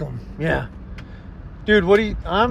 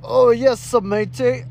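higher character voice with matey flavour; put-on shopkeeper; 6 semitones higher than usual. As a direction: play a hammed-up shopkeeper greeting. Second attempt at the shopkeeper.

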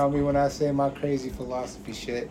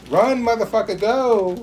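raised, higher-pitched comic exhortation; mock urgent urging; 5 semitones higher, 4 dB louder than usual. Heckling the man in the video, loud mock urgency. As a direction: urge him to bolt, half yelling.

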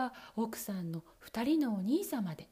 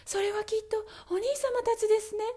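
put-on high, hopeful young wife; wife hoping her brothers come; 10 semitones higher, 4 dB louder than usual. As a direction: say it with sudden hope. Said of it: "と奥さんが言うと".

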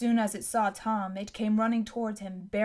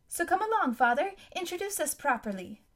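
put-on playful girl; 8 semitones higher than usual. Daughter teasing father.

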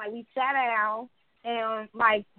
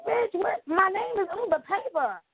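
pitched way up, mock-furious retelling; retold angry outburst; 9 semitones higher than usual. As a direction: snap back at the rude nail lady, high and indignant. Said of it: "I was so damn mad at her".

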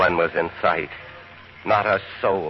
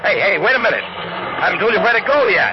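high, loud alarmed shouting; shouting at driver; 12 semitones higher, 4 dB louder than usual. Acted. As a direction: yell to be let out.

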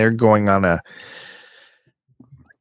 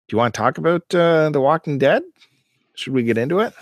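usual speaking voice

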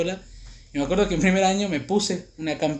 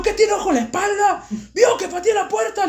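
very high, indignant yell; retold shouting; 14 semitones higher, 4 dB louder than usual. Student shouts back at teacher, high indignant yell. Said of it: "Le grité así".

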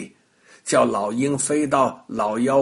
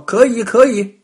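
louder, quick agreeing witch; eager witch; 8 semitones higher, 8 dB louder than usual. A narrator's reading. Witch eagerly agrees to the deal, louder. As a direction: jump at the offer.

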